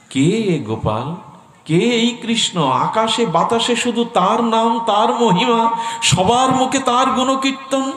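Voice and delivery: acted awe, soaring to a high pitch; rapt wonder. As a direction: ask in swelling, high-pitched amazement. Madhavi wonders about Krishna. (চড়া সুরে মুগ্ধ বিস্ময়)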